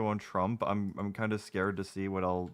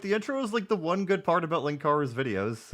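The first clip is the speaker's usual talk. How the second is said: surprised; 9 semitones higher, 6 dB louder than usual. Real, not acted. Disappointed intro skipped.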